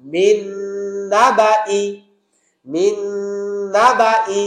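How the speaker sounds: মিন না বা ই with the নুন merged by ghunna; correct recitation. Shown as a demonstration. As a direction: repeat মিন না বা ই with nasal ghunna. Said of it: "আমরা বলবো".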